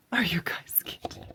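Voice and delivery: breathless, disbelieving whisper; amazed whisper over a gift; stunned whisper. Real, not acted. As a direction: hush it out in disbelief. Unboxing a surprise gift.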